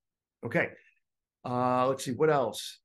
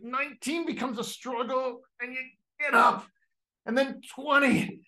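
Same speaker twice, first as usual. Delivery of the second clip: mock strain; 10 semitones higher, 3 dB louder than usual. The lifter grinding out the last rep, mock straining. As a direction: push out the last rep with effort.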